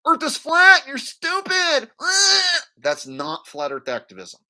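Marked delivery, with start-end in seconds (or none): 0.1-2.6 high, loud, squealed insult; mock yelling. Activist yelling at people outside, high mock yell. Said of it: "you go outside and you yell at people".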